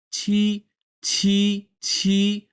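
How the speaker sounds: "ছি" with air pushed out; aspirated "ছি". Shown as a demonstration. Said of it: "বাতাস বের হয়ে যাচ্ছে". (বাতাস বের করে "ছি")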